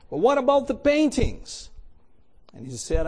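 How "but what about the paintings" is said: much higher, louder protest; startled protest. Acted. Bidder protests as auction ends, startled outcry. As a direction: cry out in disbelief.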